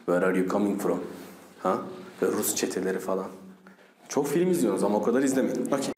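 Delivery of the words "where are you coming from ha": low Russian-accented English. Shown as a demonstration. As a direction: ask it like a Russian, pitched down. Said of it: "Rus'u anlamış mıydınız".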